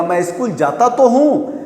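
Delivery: teacher stressing तो; emphatic stress. Shown as a demonstration. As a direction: press on तो. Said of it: "मतलब जोर लगा के बोलना".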